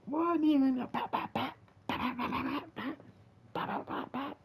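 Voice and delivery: high, excited popping sound effects; gunshot pops. Retold or imitated. Fan reliving the fight scene, in high excited pops. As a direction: rattle off the pops like gunfire.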